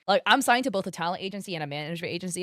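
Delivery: usual speaking voice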